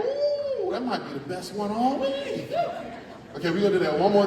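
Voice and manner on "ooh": high excited ooh; thrilled squeal of delight. Real, not acted. Reacting to the loud count.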